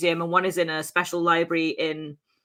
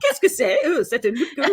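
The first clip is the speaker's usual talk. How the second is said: mock French; 6 semitones higher than usual. Playful French werewolf dialogue.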